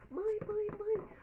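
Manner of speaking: high, loud excited calls of mum; a girl shouting for her mother as she runs in; calling mother. Acted.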